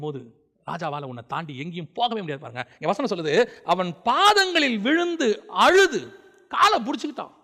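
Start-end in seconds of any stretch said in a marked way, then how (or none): 3.6-7.3 high, drawn-out, ringing verse recitation; declaimed scripture. Acted. Preacher quotes Esther falling at the king's feet, high declamation. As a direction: stretch the words out in a high impassioned cry.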